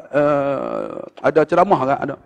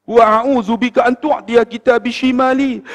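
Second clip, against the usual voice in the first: slow, high-pitched Arabic supplication; prayer recitation; 6 semitones higher, slower than usual. Retold or imitated. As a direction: recite slowly in a raised pitch.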